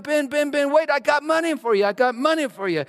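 high, loud calling after him; woman chasing the milkman down the street; mock yell. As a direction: call out to stop him. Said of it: "She was yelling".

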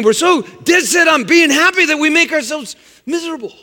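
sudden high, loud outburst; preaching shout. Real, not acted. Preacher decrying joyless believers, loud and high. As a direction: hurl the line at the congregation. Preacher erupts on a rhetorical point.

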